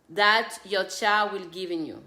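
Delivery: ordinary talk, nothing marked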